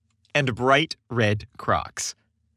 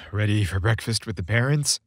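teasing; 3 semitones lower than usual. A narrator's reading. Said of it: "Chris teased".

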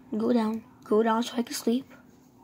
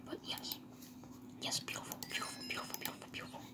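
whispered delight; 20 dB quieter, faster than usual. Real, not acted. Whispered admiring repetition.

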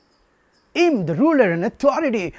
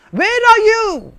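high, raised calling voice; searching call; 8 semitones higher, 3 dB louder than usual. Acted. The Word searching for man behind the trees, calling out. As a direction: call out high to someone hiding. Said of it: "came in search of man".

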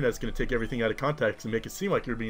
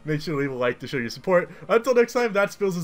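loud, high upbeat sign-off; cheerful outro pitch; YouTuber outro; 7 semitones higher, 8 dB louder than usual. Acted.

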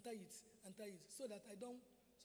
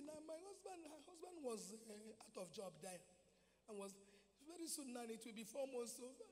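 usual speaking voice

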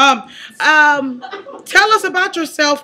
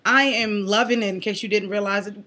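ordinary talk

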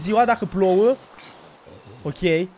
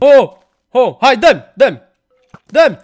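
loud, higher frantic yells; urgent shouts; 4 semitones higher, 9 dB louder than usual. Real, not acted. Player fighting a mob, urgent loud yells. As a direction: bark at the mob to hand it over.

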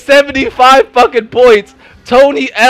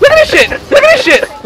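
pitched way up in disbelief; shrill-free high yelp of disbelief; high squeal; 13 semitones higher than usual. Real, not acted. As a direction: squeak it out in outrage. Gaming rage at teammate.

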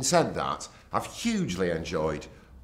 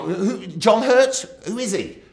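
high, slow, loud puzzled asking; baffled high question; 11 semitones higher, 7 dB louder, slower than usual. Retold or imitated. Presenter asking the crew who the guest is, baffled. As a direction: stretch it out, totally baffled.

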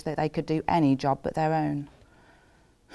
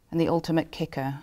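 ordinary talk, nothing marked

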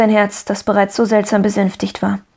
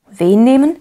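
higher, louder, rising in disbelief; baffled question; 4 semitones higher, 5 dB louder than usual. A narrator's reading. Pendleton has never heard of the boy, baffled question. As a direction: ask in startled bafflement.

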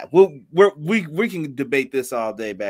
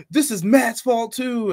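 mocking a blaming fan, higher and slow; 7 semitones higher, 4 dB louder, slower than usual. Mocking fans who blame Matt, higher slow whine.

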